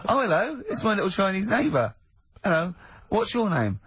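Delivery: Holy Fook. Pretend. Comic character introduces himself to the hosts in a high, silly voice. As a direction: play a silly little neighbour, opening high. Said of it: "his name's Holy Fook".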